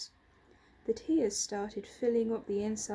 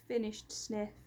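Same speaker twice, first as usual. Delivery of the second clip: usual speaking voice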